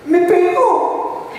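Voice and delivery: put-on high, slow drawn-out kid's whine; whiny child. Small son insisting to his father, high and slow. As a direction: stretch it out like a sulking child.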